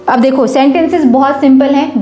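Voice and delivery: ordinary delivery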